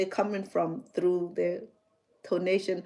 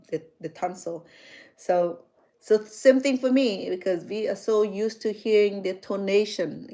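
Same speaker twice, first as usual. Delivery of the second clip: ordinary talk, nothing marked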